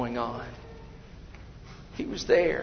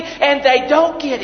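loud, high sermon voice; fiery preaching; 12 semitones higher, 12 dB louder than usual. Acted. Preacher describing the water ceremony, fiery preaching. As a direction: drive the story home to the pews.

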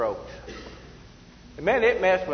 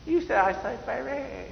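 mock Japanese or Chinese accent; 5 semitones higher than usual. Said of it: "this Japanese or Chinese, you know, dialect".

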